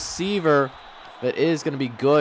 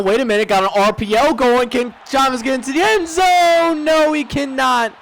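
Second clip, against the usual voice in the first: excited; 9 semitones higher, 4 dB louder than usual. Real, not acted. Near touchdown on RPO.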